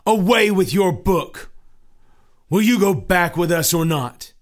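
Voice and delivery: loud, scornful demand; stubborn Obstinate. Acted.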